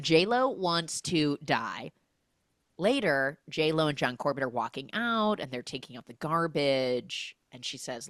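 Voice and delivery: ordinary talk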